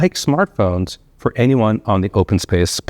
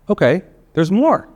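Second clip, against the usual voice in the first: keynote reveal, pitch jumping much higher; polished corporate enthusiasm; 10 semitones higher than usual. Acted. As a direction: tease the next big announcement. Keynote teasing another announcement.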